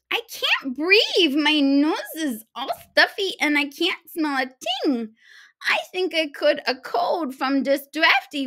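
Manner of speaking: high, loud sneezes then whiny complaining; hammed-up sniffly invalid, milking it; sneezy spoiled big girl. The big little girl claiming a cold to grab the bed, sneezing loudly.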